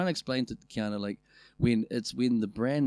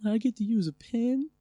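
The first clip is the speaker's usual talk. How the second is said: mock kid voice; 12 semitones higher than usual. Mocking childish pride.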